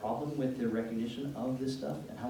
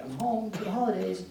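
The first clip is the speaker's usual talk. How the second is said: higher-pitched happy announcement; cheerful homecoming; 6 semitones higher, 4 dB louder than usual. Retold or imitated. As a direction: play a sister announcing she's home. Voices sister arriving home cheerfully.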